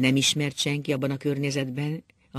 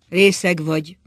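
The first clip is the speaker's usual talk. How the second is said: loud, raised scornful accusation; contemptuous; 4 semitones higher, 9 dB louder than usual. A narrator's reading. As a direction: throw it at him with contempt. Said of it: "szólt az asszony megvetően". (megvetően)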